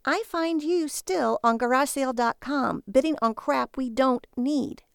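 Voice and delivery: fed-up reproach; exasperated scolding. A narrator's reading. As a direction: scold him, dragging out the last words. Wife scolds husband over his bidding.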